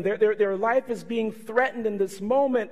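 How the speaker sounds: ordinary talk, nothing marked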